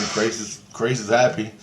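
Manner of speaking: ordinary delivery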